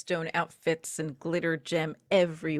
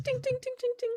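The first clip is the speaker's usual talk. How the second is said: high ding ding like a fork on glass; high tinkling imitation; 6 dB quieter, much faster than usual. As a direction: tap a goblet with your voice.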